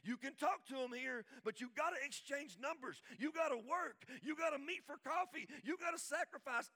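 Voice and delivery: loud, high, punchy preaching. Acted. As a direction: drive each gotta home to the crowd. Preaching at full force.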